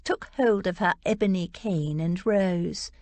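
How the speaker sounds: usual speaking voice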